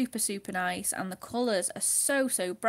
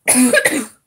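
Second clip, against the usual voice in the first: sharp dry cough; a real dry cough; 4 semitones higher, 18 dB louder than usual. Real, not acted.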